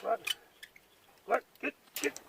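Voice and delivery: high shout from far off to send the sheep running; shooing the sheep. Real, not acted. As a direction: call it away sharply.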